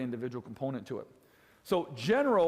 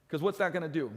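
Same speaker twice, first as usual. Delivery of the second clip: ordinary delivery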